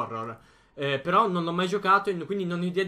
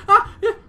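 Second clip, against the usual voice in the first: startled scream; 15 dB louder than usual. Real, not acted. Jump scare.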